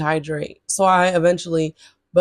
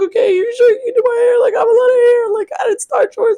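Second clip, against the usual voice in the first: high, loud and fast, on the edge of tears; retold teary whine; 6 dB louder, faster than usual. Asking her boyfriend if he can do her hair: teary high whine. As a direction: whine it out like you're about to cry. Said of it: "I'm on the verge of tears".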